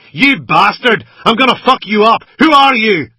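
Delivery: high, loud raging yell; screaming rant. A narrator's reading. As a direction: gunman raging at the man under him. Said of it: "screaming and ranting from him".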